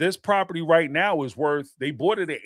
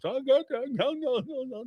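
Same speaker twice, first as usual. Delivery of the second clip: high, fading "Oh, no, no" as if not understanding English; Suki's evasive no no, pitched up; mock playing dumb; 10 semitones higher, 5 dB quieter than usual. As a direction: play innocent, pitch up and trail away. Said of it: "pretend like she don't understand English".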